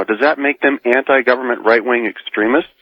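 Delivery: ordinary talk, nothing marked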